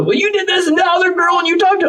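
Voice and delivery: high, shouted accusation; hammed-up high-pitched tirade; mock angry rant. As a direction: imitate a partner shrieking a complaint.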